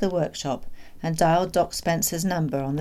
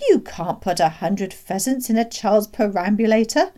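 higher, louder protest; incredulous objection; 4 semitones higher, 7 dB louder than usual. Acted. Doctor disputing the father's claim, incredulous. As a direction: object as if it's absurd.

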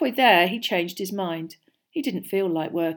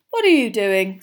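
light, friendly inquiry; kindly curious question; mild, unlike Filch; 8 dB louder than usual. A narrator's reading. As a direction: ask with casual interest, no accusation. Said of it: "in a very different voice from Filch".

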